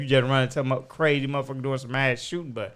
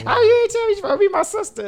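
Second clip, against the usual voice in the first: high, fast, loud mock outrage; retold indignant outburst; imitating her mad; 5 dB louder, much faster than usual. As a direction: play an offended sister, rushed and high-pitched. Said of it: "she be getting mad".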